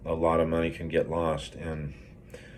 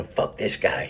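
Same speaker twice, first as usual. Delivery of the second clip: mock-defiant jab breaking into laughter; old Gary; 3 semitones higher, slower than usual. Devil on his shoulder egging him on against the boss, laughing defiance. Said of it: "old Gary on this shoulder".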